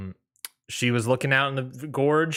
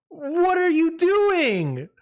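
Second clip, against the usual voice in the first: high, slow, stunned imitation of the fiancée; fiancée shocked at the proposal, mock high disbelieving voice; shocked what are you doing; much slower than usual. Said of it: "her first words out of her mouth were".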